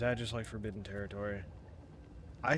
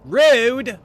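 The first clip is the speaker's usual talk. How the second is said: very loud, high yelp of protest; indignant high holler; indignant shout; 11 semitones higher, 18 dB louder than usual. Real, not acted. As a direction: yell it in outrage at the attacker. Calls out at game attack.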